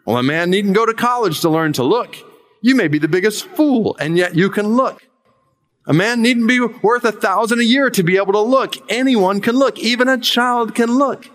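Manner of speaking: mild hammed-up chapel preaching; fervent lay preacher. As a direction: plead the simple text like an untrained preacher. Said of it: "The preacher began thus".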